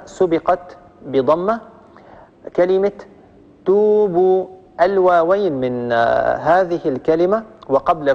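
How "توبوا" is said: توبوا with both oo vowels drawn out evenly; lengthened vowels. Shown as a demonstration. Teacher showing two madd waws, lengthened vowels. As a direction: hold each waw in توبوا as a long oo. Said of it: "وقابلية التطويل وقابلية الزيادة".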